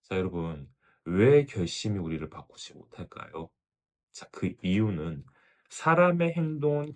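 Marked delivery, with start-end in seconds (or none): none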